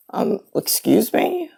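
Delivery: slow, soft, puzzled question; hesitant, drawn-out query to a rude caller; baffled politeness. Retold or imitated. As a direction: act out being taken aback, quietly. Baffled reply to an angry caller.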